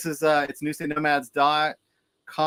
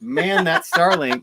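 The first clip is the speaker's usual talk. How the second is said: mock exasperation; 6 dB louder, slower than usual. Real, not acted. Mock curse at the glitchy internet.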